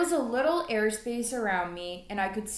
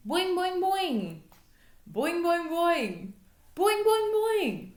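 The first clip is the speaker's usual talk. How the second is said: bouncy boing; 8 semitones higher, slower than usual. A narrator's reading. Frog hopping sound effect.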